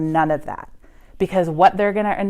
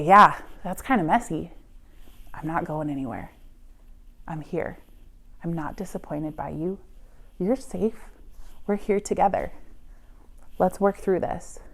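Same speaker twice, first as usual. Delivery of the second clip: soft, steady and unhurried, much quieter; calm reassurance; 8 dB quieter than usual. Shown as a demonstration. Parent soothing a struggling teenager, quiet and calm. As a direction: gently promise you're staying, no alarm at all. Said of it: "your job is to sit there and say".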